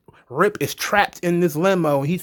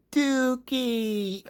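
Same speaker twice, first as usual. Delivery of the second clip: higher, softer mock Dookie; recalling the podcast bit, higher and softer; goofy quote; 8 semitones higher, 7 dB quieter than usual. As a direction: lift Dookie up and pull it back quietly. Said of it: "Bruce Pritchard talking about it".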